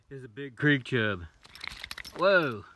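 usual speaking voice

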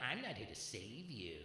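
lightly mock a girl's solemn line; mimicking girlfriend. Comic quoting a girl who dumped him, hushed mock-solemn. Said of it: "I had a girl say that to me".

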